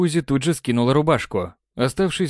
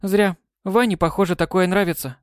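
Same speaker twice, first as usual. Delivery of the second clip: cheerful; 7 semitones higher than usual. Acted. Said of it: "радостно выдала Май".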